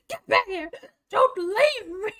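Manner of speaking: hammed-up cry after people running off; desperate pretend wail; mock desperate yell. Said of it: "Screaming my way through the tunnel".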